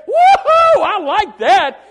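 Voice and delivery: loud, high, laughing whoop; joyful whoop. Retold or imitated. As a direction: whoop it out with a laugh, then drag out the last words. Preacher voicing his delighted reply.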